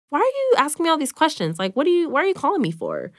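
demanding answers, rattled and suspicious; alarmed. Retold or imitated. Sister retelling the examiner's call, alarmed and high.

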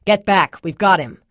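loud, firm command; with authority. A narrator's reading. Rescuer ordering the child back, authoritative. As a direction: take charge, loud and decisive. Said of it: "A voice spoke with authority".

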